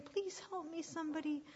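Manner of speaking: high pleading, bursting into shouts; trapped little sheep calling high; desperate cries. Acted. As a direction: call out frantically for rescue. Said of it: "Blister cried again".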